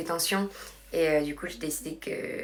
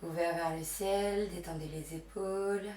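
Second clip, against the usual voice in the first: hushed, unhurried meditation guidance; guided relaxation; 12 dB quieter than usual. Real, not acted. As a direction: guide them gently into breath and rest. Leads yoga relaxation and breathing.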